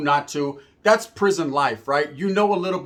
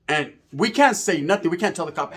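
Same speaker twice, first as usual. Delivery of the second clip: plain reading voice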